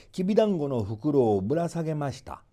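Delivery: usual speaking voice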